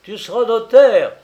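loud, high-pitched shouted command; shouting. A narrator's reading. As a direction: shout it as an order in a heated family row. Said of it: "où l'un criait".

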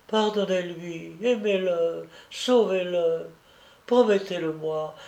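put-on a sick child comforting his big sister; dying little boy. Dying child speaks, held voice. (petit garçon mourant, voix d'enfant douce et fragile)

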